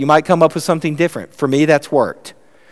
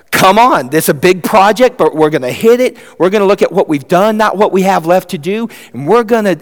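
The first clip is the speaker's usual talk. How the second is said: put-on raised, energetic rallying of the kids; acted pep talk; 4 semitones higher, 4 dB louder than usual. Parent rallying reluctant kids for a project, acted enthusiasm. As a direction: drive them on like an eager coach.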